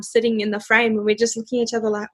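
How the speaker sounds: usual speaking voice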